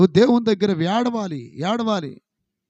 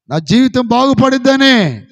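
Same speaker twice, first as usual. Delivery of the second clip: loud plea; 5 semitones higher, 10 dB louder than usual. Acted. Prayer voiced as a plea.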